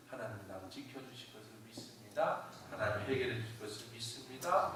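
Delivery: low, subdued prayer of trust; David. Acted. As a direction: pray it low and softly, trusting. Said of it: "다윗은 항상 그렇게 기도해요".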